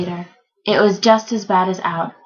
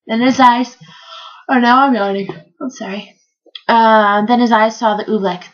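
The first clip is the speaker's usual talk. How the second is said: usual speaking voice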